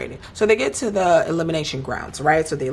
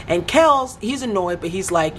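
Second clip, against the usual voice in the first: ordinary delivery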